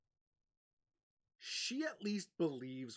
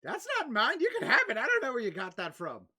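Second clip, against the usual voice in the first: put-on charmed old lady; 8 semitones higher, 11 dB louder than usual. Old lady compliments the bard, sweetly doting. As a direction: coo over the clean young man.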